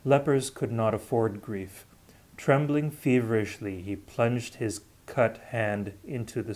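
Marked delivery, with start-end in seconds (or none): none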